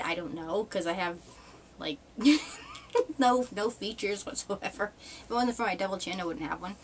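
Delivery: ordinary talk, nothing marked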